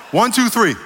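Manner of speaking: loud count. Real, not acted. Crowd count.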